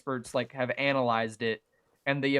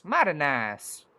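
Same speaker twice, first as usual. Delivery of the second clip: a slow, drawn-out Southern US drawl on "Mighty nice"; Southern US accent; slower than usual. Acted. Admiring his build's foliage in a slow Southern US drawl. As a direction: lean lightly into a country Southern US accent. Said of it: "Really letting my country American show".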